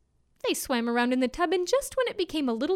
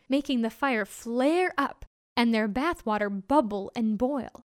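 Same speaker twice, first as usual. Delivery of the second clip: ordinary delivery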